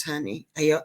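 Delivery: ordinary talk, nothing marked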